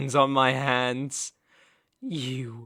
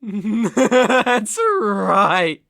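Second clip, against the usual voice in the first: loud laughing approval; gloating laugh; 4 semitones higher, 10 dB louder than usual. Acted. Master hears captive admit belonging to him, loud gloating laugh. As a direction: laugh loudly into a triumphant confirmation.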